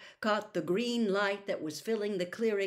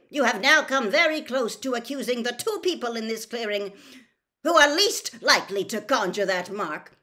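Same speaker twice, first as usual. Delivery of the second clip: loud, raised, indignant accusation; barked; 5 semitones higher, 8 dB louder than usual. A narrator's reading. Senior official rebukes his colleague, barking. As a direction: hit it hard, outraged. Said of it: "barked Mister Crouch".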